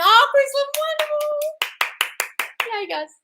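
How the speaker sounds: very high, slow drawn-out wonderful; gushing delight. Real, not acted. Thanking Chris for anniversary wishes, high sing-song. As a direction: float the word up high and slow. Gushing reaction to viewer wish.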